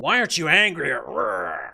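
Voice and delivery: drawn-out, hammed-up angry mimicry; mock the question with slow, exaggerated outrage; mocking imitation. Mocks the interviewer's question just played.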